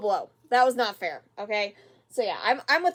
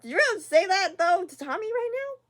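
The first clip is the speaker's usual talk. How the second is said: high, disbelieving challenge; can't believe he just said that; asking Buck in high, stunned disbelief; incredulous; 8 semitones higher than usual. Real, not acted. Incredulous at Buck's line.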